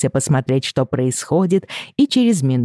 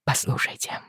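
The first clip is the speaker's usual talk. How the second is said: urgent whisper; 9 dB quieter than usual. A narrator's reading.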